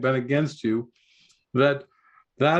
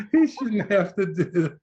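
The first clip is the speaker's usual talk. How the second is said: laughing repeat; 6 semitones higher than usual. Real, not acted. Teacher repeating the skit line through laughter.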